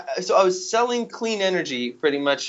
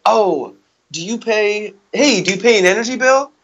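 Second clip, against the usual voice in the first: bright, higher chirpy pitch opener; sales pitch voice; 5 semitones higher, 4 dB louder than usual. Retold or imitated. Canvasser greeting theatergoers, higher and upbeat. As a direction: sell it like an eager street canvasser. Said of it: "That was my".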